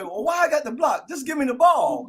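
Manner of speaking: put-on high, excited young boy voice; the kid thinking he can take it all the way; his younger self. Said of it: "I was thinking this way".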